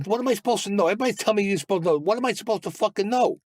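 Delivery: high, frustrated demand, rising in anger; retold exasperated shout at the bosses, pitched high; exasperated outburst. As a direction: insist on an answer you're not getting.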